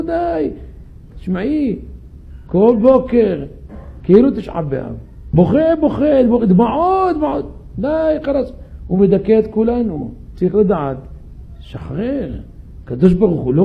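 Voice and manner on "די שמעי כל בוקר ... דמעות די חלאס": fed up, ridicule the weeping; exasperated mock of a crier; mocking exasperation. [name] mocks David's daily crying.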